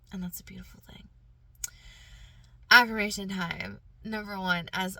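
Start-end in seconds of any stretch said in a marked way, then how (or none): 0.1-1.1 voiceless, tender whisper; hushed. Real, not acted. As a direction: drop to a near-whisper to close the thought. Hushed close to her message.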